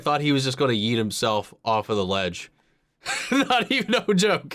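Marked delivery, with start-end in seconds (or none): 2.9-4.5 high-pitched, laughing through the words. Real, not acted. Reactor amused by droid nearly yeeting himself, laughing. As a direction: say it through real giggles.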